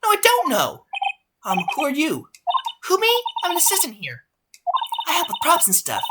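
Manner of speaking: put-on bright, squeaky young assistant voice; high chirpy assistant. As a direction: play the perky prop helper.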